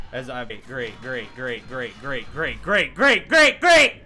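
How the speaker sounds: from muttered to yelled great; sarcastic building rant. Real, not acted.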